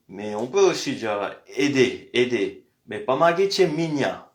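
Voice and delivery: ordinary delivery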